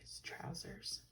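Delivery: whispered aside to the listener. Real, not acted. Reader whispering to the child about the trousers. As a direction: breathe it out as a secret.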